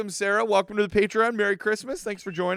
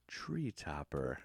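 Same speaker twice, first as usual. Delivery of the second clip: imitating Danny; 11 semitones lower, 17 dB quieter, much slower than usual. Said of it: "imagine Danny being like".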